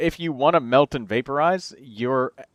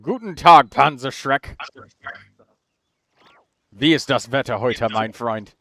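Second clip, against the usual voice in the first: playful German greeting. Pretend. Streamer greets viewer Panzer in German, playfully. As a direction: greet him in German, hamming it up a bit. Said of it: "I don't have a German accent".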